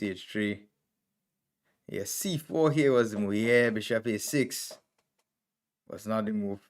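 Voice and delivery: ordinary talk, nothing marked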